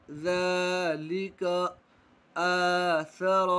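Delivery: आदम with the first आ held long; slowly drawn out. Shown as a demonstration. Teacher drawing out आदम for the class. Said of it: "खींचकर पढ़ेंगे".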